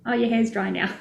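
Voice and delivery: ordinary delivery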